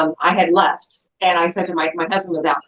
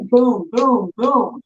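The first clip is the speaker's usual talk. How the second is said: three spaced booms for door knocks; punched-out knocking noises, pitch rising; imitated hard knocks; 5 semitones higher, much slower than usual. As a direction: imitate someone pounding on a door. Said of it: "three hard, like, knocks".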